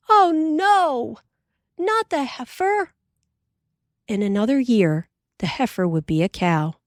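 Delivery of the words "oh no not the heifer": much higher, pleading protest; dismayed cry. A narrator's reading. Mary protesting to Pa about selling the heifer, dismayed high cry. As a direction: cry out against losing the calf. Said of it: "Mary cried out".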